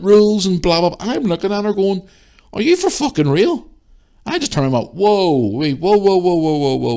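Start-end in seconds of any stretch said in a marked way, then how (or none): 2.5-3.7 very high-pitched disbelief; incredulous challenge. Retold or imitated. Shopper facing a woman's rant, incredulous challenge. Retelling an argument in store. 4.9-6.9 rapid-fire high-pitched whoas; halting interruption. Retold or imitated. Shopper stopping a woman mid-rant, halting interruption.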